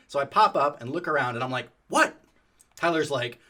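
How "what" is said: sharp, much higher, louder yelp; alarmed what. Retold or imitated. Popping up from the engine, startled high yelp. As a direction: jump at a sudden fright.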